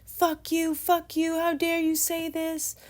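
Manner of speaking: reliving the moment she screamed at him; retold angry yelling. To her brother at the party, a high furious yell. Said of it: "I remember cussing him out".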